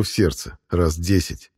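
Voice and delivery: ordinary delivery, nothing marked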